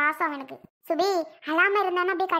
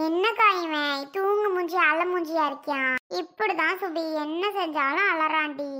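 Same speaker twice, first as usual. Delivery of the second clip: ordinary talk, nothing marked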